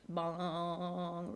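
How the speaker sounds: drawn-out imitated gong; reverberating bong. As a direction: let the word ring on like a struck bell.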